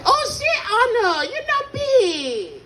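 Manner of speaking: very high, drawn-out mock Chinese accent. Server sees him after weight loss, squealed Chinese accent. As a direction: falsetto Chinese-accented exclamation, slowing at the end. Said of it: "I'm so good at that accent".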